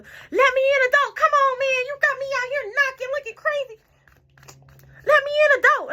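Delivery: high-pitched mocking whine at the door; mock his stupid voice, pleading up high; stupid man's voice. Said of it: "this how stupid his voice was".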